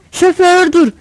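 high, loud call to stop; shouting passenger. A narrator's reading. Woman passenger yelling to the driver, high acted shout. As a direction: call out loudly to halt the driver. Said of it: "bağırır".

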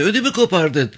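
high, flustered woman's voice; startled woman, voice pitched well up; startled wife. Acted. Startled woman scolds child, shows heart.

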